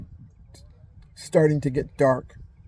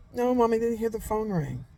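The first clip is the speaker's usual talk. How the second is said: a hint of a small daughter, higher and softer; lightly voiced little girl; 7 semitones higher, 6 dB quieter than usual. Little daughter telling mommy she heard no ring, lightly childlike. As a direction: answer sweetly like a little girl.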